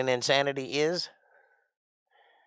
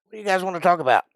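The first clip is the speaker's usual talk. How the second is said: brisk host; 7 semitones higher, much faster than usual. Acted. Host brightly asking audience.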